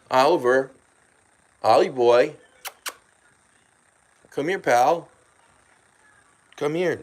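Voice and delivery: loud, high calling out; calling a pet. Real, not acted. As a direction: call the cat over. Calling the cat over.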